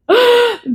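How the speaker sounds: a sudden, high laugh breaks out; high burst of laughter. Real, not acted. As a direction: crack up at your own joke. Laughs at her own quote.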